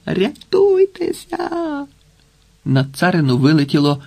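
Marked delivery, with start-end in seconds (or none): 0.1-1.9 very high, drawn-out distant scream; long wailing alarm call; desperate cry. A narrator's reading. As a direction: call out slowly in despair, pitch soaring. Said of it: "долетіло розпачливе".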